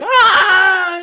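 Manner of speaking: high, loud mock scream of burning men; imitated scream. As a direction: let out a hammed-up shriek. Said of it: "hear the English screaming".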